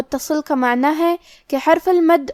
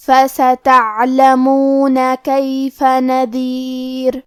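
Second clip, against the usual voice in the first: slow, drawn-out chanted tajweed recitation; Quran recitation; much slower than usual. Acted. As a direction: recite each phrase slowly and melodically. (آہستہ، لمبی کھینچی ہوئی تجوید والی تلاوت)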